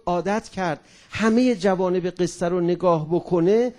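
ordinary talk

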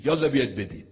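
loud barked command; commanding order. Shown as a demonstration. Preacher mocking a bossy order, loud command. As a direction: order them about like a boss. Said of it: "دستور نمیده که".

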